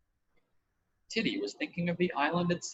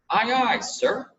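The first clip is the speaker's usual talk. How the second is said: slow, louder sailor's reply; shipboard aye aye; 7 semitones higher, 6 dB louder, slower than usual. A narrator's reading. Titty shows Roger the proper reply, slow and loud. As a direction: snap it out like a sailor. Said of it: "You ought to have said".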